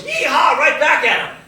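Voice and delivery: high, roaring answering whoop; shouted cheer. Acted. Audience cheering back at the players, high shouted whoop. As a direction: holler back at full voice. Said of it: "the audience goes".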